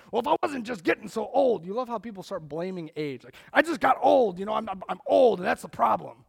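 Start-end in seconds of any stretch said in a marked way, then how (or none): 0.1-1.7 loud, high-pitched mock griping; mock complaint. Acted excuse of hip patients. 3.5-6.2 mock complaint. Acted excuse, blaming age.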